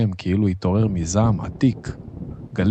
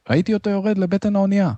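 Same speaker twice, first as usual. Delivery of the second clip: high, fast call; urgent report; 12 semitones higher, faster than usual. A narrator's reading. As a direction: rush the answer out at high pitch. Sailor answers captain in storm. (דיווח בהול, גבוה ומהיר)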